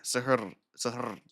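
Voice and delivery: trying suhoor without rolling its final R; low attempt at suhoor with an untrilled R; unrolled R attempt. Shown as a demonstration. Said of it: "say it without rolling the R".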